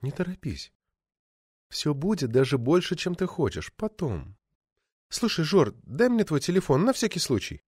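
soft, intimate female character voice; alluring actress. Acted. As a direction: promise softly, then ask lightly.

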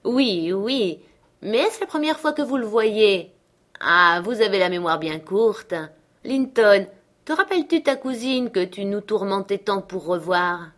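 a hint of an emphatic father; lightly acted prodding father. Heathcliff reminding his son of his cousin, lightly acted prodding. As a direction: prod them to remember, lightly acted.

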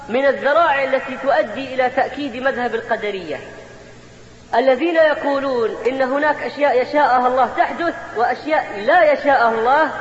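ordinary talk

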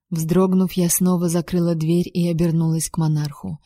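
plain reading voice

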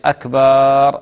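أكبر drawn out as "akbaaar"; slow, faulty "akbaaar" in أكبر; stretched baa. Shown as a demonstration. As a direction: lengthen the last vowel of أكبر. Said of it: "يمد باء أكبر".

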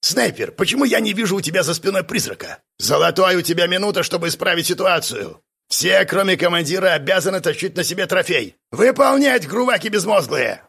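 angry drill instructor. A narrator's reading. Instructor berates squad for missing trophies, yelling. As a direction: chew out the recruits.

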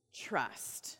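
one breathy hushed word; whisper. Real, not acted. Preacher revealing the key difference in a whisper. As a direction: drop to a whisper for weight.

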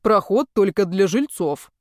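a hint of a stern doorman; lightly voiced surly doorman; gloomy doorman. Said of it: "хмурый швейцар".

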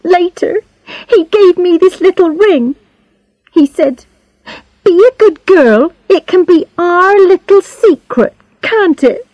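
put-on high-pitched, upset girl telling her secret; tearful Charlotte. A narrator's reading. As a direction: tell it in a high girl's voice, close to tears. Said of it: "She started to cry".

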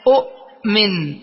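أؤمن slowly, short u cut by a glottal stop, then a gap; original two-hamza form. Shown as a demonstration. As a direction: break أؤمن sharply after the first syllable. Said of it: "فالثانية ساكنة والأولى مضمومة".

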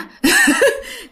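high-pitched little chuckle; a short high laugh after the question; light laugh. Retold or imitated.

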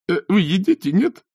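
very high, thin, strained voice; nervous falsetto; squeaky innkeeper. A narrator's reading. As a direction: keep squeaking as you grope for words. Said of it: "пропищал он неестественно тонким голоском".

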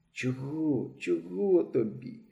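тихе сонне бурмотіння, повільно; пробурмочи напівсонно; спросоння. A narrator's reading. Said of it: "пробурмотів з просоння". (хлопець тихо бурмоче крізь сон)

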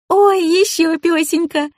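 put-on high, drawn-out girlish delight; slow, very high happy gushing; overjoyed. A narrator's reading. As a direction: coo with joy at another little dog. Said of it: "обрадовалась Амалия".

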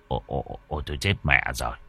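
whispering bad news to his wife; said low to wife. Husband quietly tells wife there's no dog. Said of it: "nói nhỏ với vợ".

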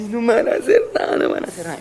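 high mock-tearful victim, easing at the end; imitated crying plea. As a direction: plead like the weeping old woman. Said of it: "la cucha lloraba y era así". (súplica llorosa imitada, aguda)

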